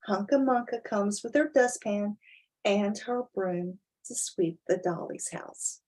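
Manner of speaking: ordinary delivery, nothing marked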